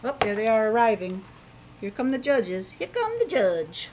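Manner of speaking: ordinary delivery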